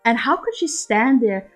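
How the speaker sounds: ordinary delivery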